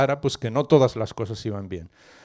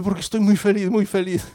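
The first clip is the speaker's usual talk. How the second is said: high-pitched, giddy little boy, acted; overjoyed child; 7 semitones higher than usual. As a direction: play a child bursting with happiness. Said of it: "¿Tú por qué estás tan feliz?". (niño felicísimo, fingido)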